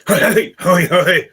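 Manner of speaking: flustered, high, loud calling; fumbling man. Acted. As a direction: call out as a bumbling clerk in a fluster. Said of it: "this man fumbling over".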